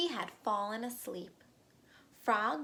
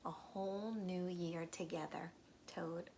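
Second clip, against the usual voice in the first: softly whispered coaxing; 3 semitones lower, 6 dB quieter than usual. Acted. Frog coaxing sleepy Toad, whispering softly. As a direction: whisper it like a secret promise.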